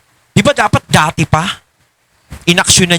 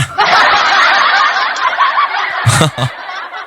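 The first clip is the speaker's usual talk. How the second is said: high burst of real laughter. Real, not acted. Laughing at the absurdity. (malakas na tawa)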